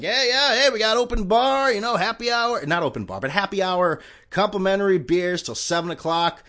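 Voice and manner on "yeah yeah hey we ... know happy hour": eager bartender. Retold or imitated. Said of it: "bartender's like".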